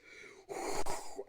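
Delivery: airy laugh under the breath; breathy laugh. Real, not acted. Recalling flames in her face, real breathy laugh. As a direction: laugh at your own mishap.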